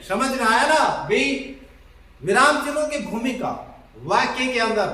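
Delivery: usual speaking voice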